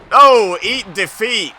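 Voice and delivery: high, loud, drawn-out finisher call; shouted call. Real, not acted. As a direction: stretch it out and belt it.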